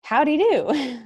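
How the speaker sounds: slow, high mock of the misheard question; misheard drawl. What the aide heard the doctor ask, a slow mock drawl. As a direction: drag it out the way she misheard it. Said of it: "So I heard".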